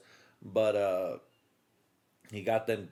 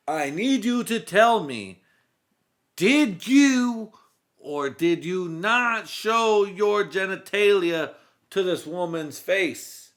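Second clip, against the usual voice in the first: higher, louder, slow cop demand; cop interrogation; 8 semitones higher, 8 dB louder, slower than usual. Retold or imitated. Cop re-asking suspect, slow and loud. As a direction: drag out each word like a furious detective. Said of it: "retake of the cop line".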